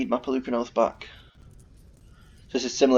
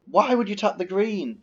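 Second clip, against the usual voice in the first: high, slowed exasperated question; exasperated rant; 9 semitones higher, slower than usual. Real, not acted. Streamer repeating his complaint, slow high exasperation. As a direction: drag it out in disgust.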